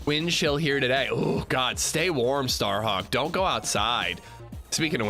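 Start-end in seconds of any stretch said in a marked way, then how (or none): none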